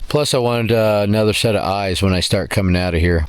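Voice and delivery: ordinary talk, nothing marked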